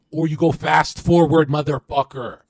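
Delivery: loud, slow, forceful yell; emphatic shout. Real, not acted. Streamer hyping listeners to charge ahead, emphatic shout. Second option shouted against the first.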